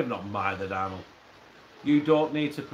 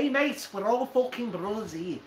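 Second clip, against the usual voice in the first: higher mock Mancunian voice; Manchester accent; 5 semitones higher than usual. Shown as a demonstration. Mate taking up the request, mock Manchester accent. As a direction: put on a raised Manchester accent. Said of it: "what about the Manchester one?".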